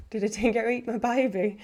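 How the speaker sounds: put-on Australian accent. Streamer guessing at the animal on a sticker, in a mock Australian accent. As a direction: do a playful, not-quite-best Australian accent.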